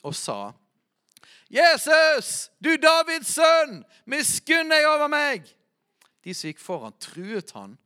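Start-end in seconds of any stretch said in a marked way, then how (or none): 1.5-5.5 high, loud desperate cry for mercy; blind man shouting. Acted. Blind beggar crying out to Jesus, loud desperate shout. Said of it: "Og han ropte og sa".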